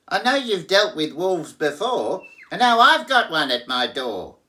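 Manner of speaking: high, loud frantic piglet. Acted. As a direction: rush it out in fear.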